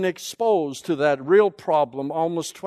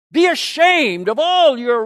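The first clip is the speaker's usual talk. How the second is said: louder, higher, scolding the people; stern rebuke; 5 semitones higher, 7 dB louder than usual. Acted. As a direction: thunder it at them like a prophet. Prophet scolding the exiles.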